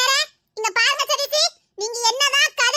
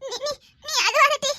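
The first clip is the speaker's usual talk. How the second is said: ordinary talk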